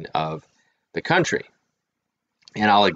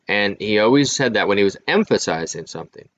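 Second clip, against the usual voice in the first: usual speaking voice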